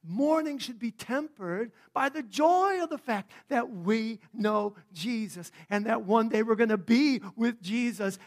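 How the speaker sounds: loud, rising sermon build; preaching. Acted. Pastor preaching joy to the congregation, full force.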